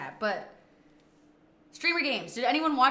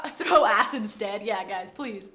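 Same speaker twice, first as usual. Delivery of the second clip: ordinary talk, nothing marked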